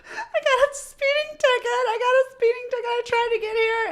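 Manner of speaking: high wailing retold co-star in tears; mock sobbing. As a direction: imitate a tearful panic about a ticket. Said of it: "She's sobbing".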